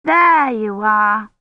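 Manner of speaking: slow, glad greeting; pleased to have found her at last; little girl happily finding Kivrin; happy. A narrator's reading. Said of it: "she said happily".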